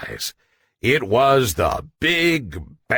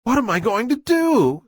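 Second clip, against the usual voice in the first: worried guppy; 12 semitones higher, faster than usual. Acted. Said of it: "said Guppy Goop".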